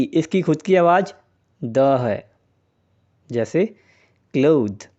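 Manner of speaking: usual speaking voice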